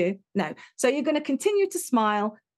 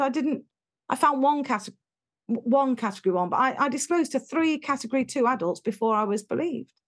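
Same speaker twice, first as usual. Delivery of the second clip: usual speaking voice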